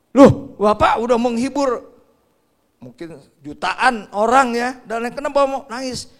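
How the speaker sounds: loud, high, drawn-out disbelief; astonished questioning. Acted. Reporter questioning a crying circus comedian, loud astonishment.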